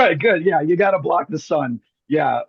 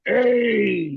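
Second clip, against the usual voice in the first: long New York "Hey", pitched up; New York accent; 6 semitones higher than usual. Shown as a demonstration. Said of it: "in New York, you can go".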